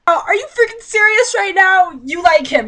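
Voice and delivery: loud, high "are you freaking serious"; real outburst of disbelief; exasperated disbelief. Real, not acted. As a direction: throw your hands up at the page. Reader exasperated at the book.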